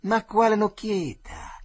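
high, loud and slow, jeering; taunting bully's cry; mocking yell. A narrator's reading. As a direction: shout it down at him. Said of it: "disse il Tirillo".

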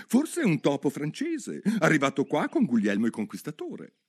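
put-on a curious girl talking to herself; Alice thinking aloud. Alice muses alone in the lake, light girl voice.

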